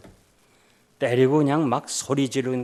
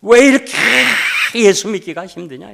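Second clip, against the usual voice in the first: high, loud anguished wail; wailing cry; 9 semitones higher, 12 dB louder than usual. Retold or imitated. Deaconess wailing to the pastor at night, anguished cry. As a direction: cry it out like a woman breaking down. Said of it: "통곡을 하는 거예요".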